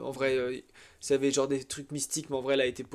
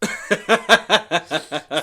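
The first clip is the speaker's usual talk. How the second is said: laughing; 2 semitones higher, 8 dB louder than usual. Real, not acted. Laughing, drawn out.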